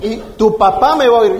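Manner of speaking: loud, indignant threat; mock-furious and emphatic; acted angry threat. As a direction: threaten the kid, raising your voice. Angry neighbour threatens the boy.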